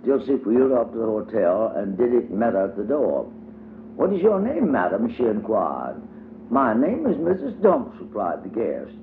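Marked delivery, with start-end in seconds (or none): 4.0-5.1 high little girl's voice, playing hostess; child voice for Diddy. A narrator's reading. Diddy greets her playmate at the door, high childlike voice. 6.5-8.0 put-on high little girl's voice, proudly introducing herself; child voice for Dumps. A narrator's reading. Dumps tells Diddy her pretend name, high childlike voice.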